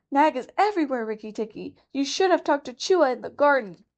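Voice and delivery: a timid rat afraid of being overheard; fearful muskrat. Acted. Chuchundra warns fearfully.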